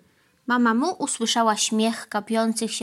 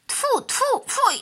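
high spitting tfu tfu; mock disgusted spitting; slower than usual. A narrator's reading. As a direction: spit out the taste with disgust.

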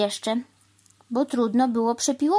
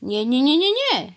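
rapid string of no's climbing high; louder, rising protest; emphatic refusal; 6 semitones higher, 5 dB louder than usual. A narrator's reading. Crow refusing the cow's idea, emphatic protest.

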